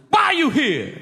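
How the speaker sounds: high, forceful mock shout; interrogating prisoner. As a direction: demand an answer as a scary inmate. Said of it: "the prisoner goes".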